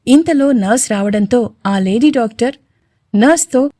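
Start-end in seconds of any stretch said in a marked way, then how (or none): none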